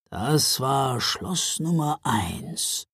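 lightly voiced old Father Frost, slow; a hint of slow, calm old man. A narrator's reading. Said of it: "sagte Väterchen Frost".

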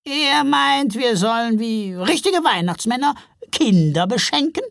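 high, loud troll voice; incredulous trolls. A narrator's reading. Trolls checking the Santas' proposal, incredulous.

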